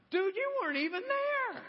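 high, disbelieving mock reaction; incredulous retort.

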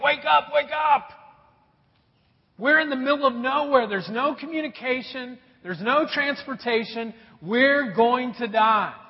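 high, loud panicked plea; panicked shout. Retold or imitated. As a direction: yell it like you're stranded and doomed. Panicked guy on deserted island.

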